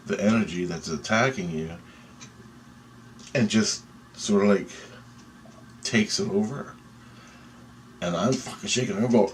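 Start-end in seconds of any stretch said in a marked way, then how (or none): none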